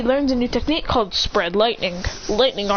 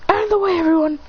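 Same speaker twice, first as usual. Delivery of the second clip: high urgent call; 7 semitones higher, faster than usual. Acted. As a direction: call them clear. Hero warns friends.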